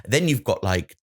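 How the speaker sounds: ordinary delivery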